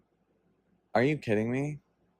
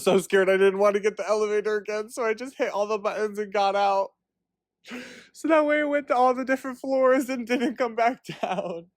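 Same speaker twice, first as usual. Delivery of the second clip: high-pitched, tickled telling through laughter; laughing while talking; 9 semitones higher than usual. Real, not acted. Confessing her elevator prank, laughing and high-pitched. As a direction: squeal the story through giggles.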